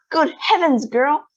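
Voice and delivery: high loud screech. A narrator's reading. Mother screeches at the swelling girl, high and loud. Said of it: "screeched Missus Beauregard".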